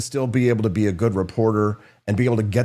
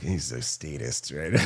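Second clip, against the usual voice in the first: muttered aside; 9 semitones lower, 9 dB quieter, slower than usual. Retold or imitated. Quiet aside about Gary.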